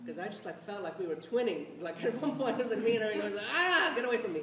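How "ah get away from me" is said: laughing. Pretend. Mock push-off between friends.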